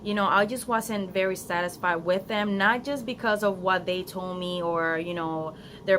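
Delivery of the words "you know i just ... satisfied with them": usual speaking voice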